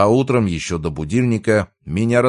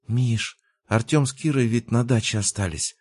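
put-on wheedling girlfriend, pitched up; ingratiating; 6 semitones higher than usual. A narrator's reading. Girlfriend hinting to boyfriend on the stairs, ingratiating. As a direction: coax him sweetly in a higher voice. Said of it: "заискивающе начала девушка".

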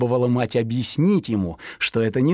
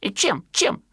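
higher questioning chirp; higher baby-bird question; curious fledgling; 9 semitones higher than usual. Acted. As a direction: ask it like a curious little sparrow. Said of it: "спрашивал Пудик".

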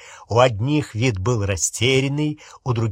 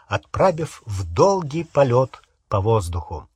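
usual speaking voice

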